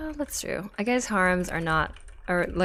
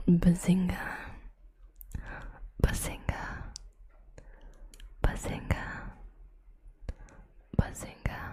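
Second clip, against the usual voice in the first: whispering in your ear; 4 dB quieter than usual. Pretend. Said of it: "Sheldon whispering into your ear".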